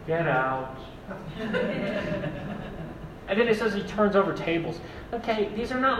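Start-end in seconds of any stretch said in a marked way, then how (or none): 0.1-0.8 flat, chill, straight-faced command; deadpan get out. Retold or imitated. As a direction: say it calmly with no anger at all. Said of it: "straight-faced, kind of chill about it".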